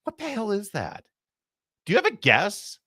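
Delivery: high, incredulous what-the-hell; stunned by the accent in the clip, high and baffled; baffled disbelief. Real, not acted. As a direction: ask it like you can't believe your ears. Baffled host reacts to clip.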